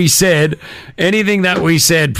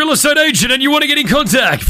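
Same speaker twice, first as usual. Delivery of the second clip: ordinary delivery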